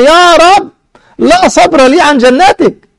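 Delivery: high, impassioned, slow-stretched entreaty; intense hopeful pleading. Retold or imitated. Servant reminded of his promise begs God again, intensely pleading. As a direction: plead with desperate hope. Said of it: "شوف شدة الرجاء هنا بقى أهو".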